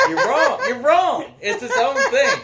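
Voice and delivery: high laughing protest. Real, not acted. Laughing while arguing with cohost.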